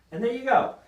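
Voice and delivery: bright sign-off to the camera; upbeat praise. Real, not acted. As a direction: coach proudly ending the demo. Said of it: "coach wrapping up demo".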